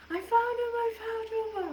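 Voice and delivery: very high, loud triumphant shout; excited mom. Retold or imitated. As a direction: shout the news, pitch way up. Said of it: "She's like".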